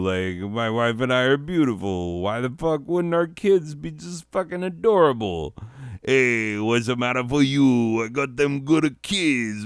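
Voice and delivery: high, swaggering tough-guy voice; cocky proud dad. Pretend. Father showing off his real baby, in a cocky high voice. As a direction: brag like a smug street dad. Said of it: "whip out the real baby and be like".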